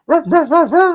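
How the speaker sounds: high yappy dog barks; dog barking. Pretend. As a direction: bark like a small dog. Greeting Lone Wolf in chat.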